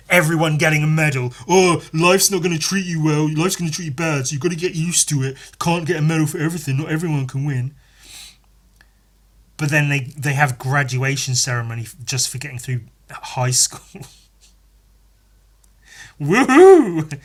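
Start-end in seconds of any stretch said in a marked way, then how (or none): 1.5-7.7 higher mocking voice, run together in a griping rush; mocking naysayer. Mimics people angry about participation medals. 16.2-17.2 high, loud mock whoop; mock cheer. Mock cheering a school graduate.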